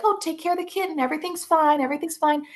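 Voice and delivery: high, breezy mock-soothing voice; dismissive grandmother. Grandmother brushing off the abuse, high and breezy. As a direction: chirp it up high like it's all nothing. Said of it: "to just be like".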